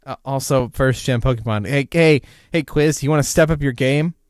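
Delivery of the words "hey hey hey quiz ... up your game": loud, heckling call-out to the quiz; mock challenge. Real, not acted.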